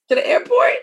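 high, loud burst; excited exclamation. Retold or imitated. Host mimicking mom rushing off to the airport, high and loud. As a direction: announce the airport like it's urgent.

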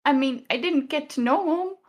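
high-pitched, halting attempt at consolation; Lila, earnest comforter. Plays Lila comforting a grieving companion.

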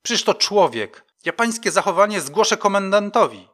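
loud, high, outraged rebuke; indignant outburst. A narrator's reading. Coroner defending a homeless man to the sergeant, indignant. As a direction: rise into an outraged threat. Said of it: "zawołała oburzona".

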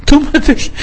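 put-on a childish pout at the table, pitched far up; child scolding a table.

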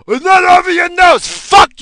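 high, loud, whiny mock voice; mocking Karen. Mimicking a Karen scolding him about masks, shrill mock voice. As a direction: parody a nagging shopper.

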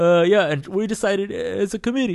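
high, loud, put-on timid voice; mock sheepish boys making excuses; scared boys. Studio mates answering the angry painter, like scared boys. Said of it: "we're all, like, scared boys".

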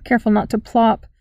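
plain reading voice

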